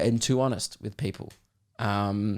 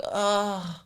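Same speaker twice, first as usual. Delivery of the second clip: high, softer, long exasperated sigh; bratty groan; 7 semitones higher, 5 dB quieter than usual. Acted. Comedian showing the bratty girl's whole vibe, put-upon groan. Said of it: "that was just her whole vibe".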